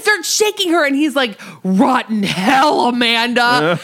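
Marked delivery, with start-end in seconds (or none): 1.6-3.8 loud, drawn-out shout of fury; enraged yell. Acted. Bruce shaking Amanda in a rage, enraged yell. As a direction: bellow it like a furious curse. Retelling Bruce's furious attack.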